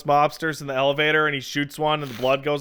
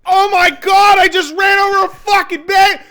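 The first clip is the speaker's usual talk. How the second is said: high, screaming mock panic; hammed-up freak-out at a terrible accident; going crazy; 9 dB louder than usual. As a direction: shout it in hysterical horror. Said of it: "all go fucking crazy".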